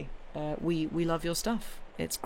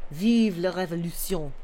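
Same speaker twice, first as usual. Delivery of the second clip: Vive la révolution in a French accent; proud French-accented rallying line. Shown as a demonstration. Streamer finally saying the slogan in a French accent. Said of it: "Finally said it in a French accent".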